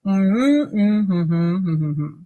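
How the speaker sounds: closed-mouth hum; hummed speech. Shown as a demonstration. Teacher humming words with mouth closed. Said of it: "But you will sound like".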